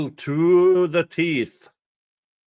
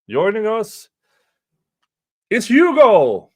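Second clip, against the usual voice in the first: excited announcement; 9 semitones higher, 7 dB louder than usual. Real, not acted. Introducing a guest on stream.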